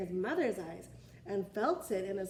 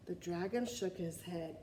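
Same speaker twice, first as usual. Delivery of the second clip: usual speaking voice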